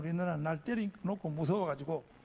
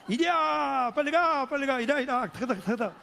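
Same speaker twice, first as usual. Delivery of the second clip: whooping rider's cry, easing into hoofbeat sounds; mock horse-driving call; 5 semitones higher, 5 dB louder than usual. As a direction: urge on a horse in childish play. Rides sister like a horse.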